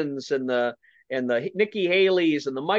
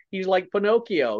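normal talking voice

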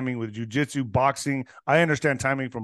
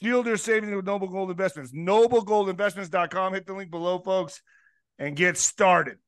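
upbeat sponsor read, pitched up; 5 semitones higher than usual. Acted. Host pitching gold investments to listeners, ad read. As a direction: sell it like a podcast sponsor spot.

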